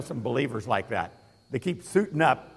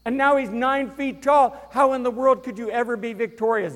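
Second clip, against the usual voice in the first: awed build-up; 9 semitones higher, 4 dB louder than usual. Acted. Preacher acting out Goliath's size.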